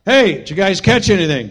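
calling out. Retold or imitated. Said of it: "cries out".

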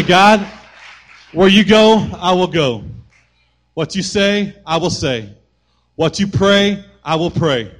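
loud, high pulpit declaration; full-force sermon vow; impassioned preaching. Acted. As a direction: proclaim each line with force. Preaching prayer climax.

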